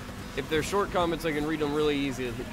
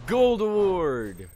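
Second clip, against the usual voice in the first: announcer voice; 7 semitones higher, 8 dB louder, much slower than usual. Pretend. Announcing prize after race.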